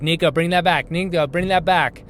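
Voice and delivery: put-on loud, high calling after a running kid; retold yell. Dad shouting after his son running down the hall, loud and urgent. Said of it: "And I yelled so loud".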